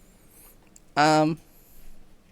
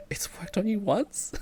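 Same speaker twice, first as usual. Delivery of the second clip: whispering; 5 semitones higher, 5 dB quieter than usual. Acted. Dad's reply read aloud.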